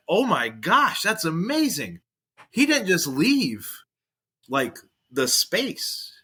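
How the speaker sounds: mock thrilled. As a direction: marvel at it like a fan. Imagined thrilled inner voice.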